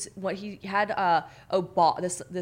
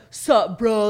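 American bro; 2 semitones higher, 8 dB louder than usual. Retold or imitated. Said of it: "they'd be like".

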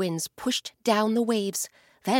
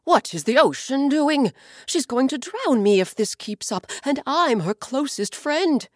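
louder, higher, anxious voice; a hint of a frightened sky; alarmed sky; 5 semitones higher, 6 dB louder than usual. Sky asking the bird why the ocean attacks, alarmed.

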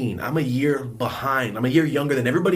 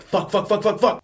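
higher, rapid-fire panicked swearing; retold panic; 8 semitones higher, 4 dB louder than usual. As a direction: replay a sudden oh-no moment. Retelling his sudden panic mid-slam.

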